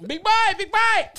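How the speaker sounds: high, loud mock chant. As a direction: squeak it out laughing. Imitating Lao speech.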